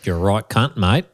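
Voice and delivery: mock Aussie insult; put-on Australian twang; Australian accent. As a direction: imitate an Australian mate cursing. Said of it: "One of my friend is Australian".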